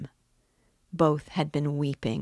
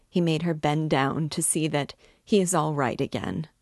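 ordinary delivery

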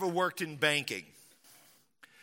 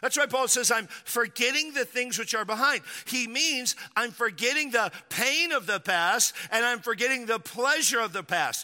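raised, loud, building sermon voice; preaching at full force; 7 semitones higher, 4 dB louder than usual. Real, not acted. Pastor to congregation, preaching at full force. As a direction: drive it home to the congregation.

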